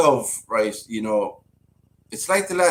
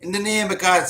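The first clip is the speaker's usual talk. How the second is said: raised, emphatic Irish "in the name of God"; Irish accent; 9 semitones higher, 4 dB louder than usual. Retold or imitated. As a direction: exclaim it like an Irish mammy. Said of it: "With the Irish accent on her".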